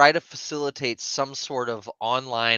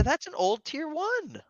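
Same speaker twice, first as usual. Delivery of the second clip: much higher, slow teasing; high mocking voice; 4 dB quieter, slower than usual. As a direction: tease in a squeaky put-on voice. Teasing put-on reply.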